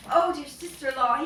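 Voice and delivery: loud, high plea; pleading cry. A narrator's reading. Sancha pleading with her sister-in-law, crying out. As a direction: beg her to stop.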